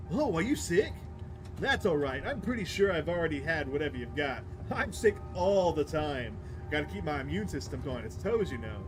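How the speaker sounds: breezy, oblivious chatterbox; chatty young prankster. Acted. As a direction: prattle on like an unbothered talkative passenger. Voices talkative bus passenger in game.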